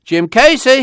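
high-pitched hail; long-range holler, pitch way up; calling out. A narrator's reading. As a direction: call across a yard for someone. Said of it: "he called".

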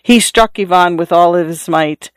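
usual speaking voice

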